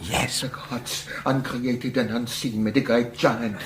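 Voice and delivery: low, soft lecturer; subdued, low-pitched mumbled lecture; too quiet to hear. Acted. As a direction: murmur the lecture without projecting. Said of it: "could you speak up a little".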